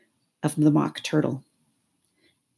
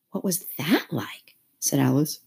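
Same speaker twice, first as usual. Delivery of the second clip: whisper. A narrator's reading. Whispered line.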